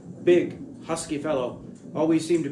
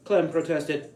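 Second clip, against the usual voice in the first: usual speaking voice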